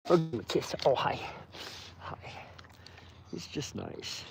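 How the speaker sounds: soft unvoiced whisper. Real, not acted. Owner whispering fondly to her wolf. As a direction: breathe it tenderly to him.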